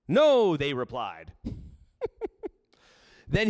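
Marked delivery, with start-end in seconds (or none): 0.1-0.6 loud answer. Acted. Disciples voiced answering Jesus.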